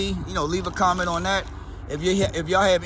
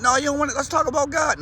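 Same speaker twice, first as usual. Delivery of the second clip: corner them with a raised, eager preacher voice; pushy preacher voice; 8 semitones higher than usual. Retold or imitated. Pushy believer chasing people down.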